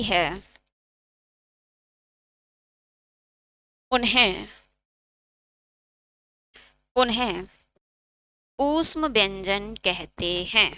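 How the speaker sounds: measured, drawn-out classroom dictation; slow dictation. Acted. Teacher giving students a definition to write down, slow dictation. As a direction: dictate word by word, long pauses.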